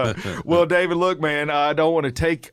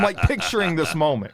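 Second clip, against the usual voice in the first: ordinary delivery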